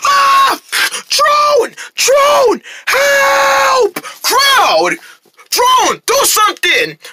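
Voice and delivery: high, hammed-up screams for rescue; panicked cry for help. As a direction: yell out desperately to be saved. Calling for help from creature.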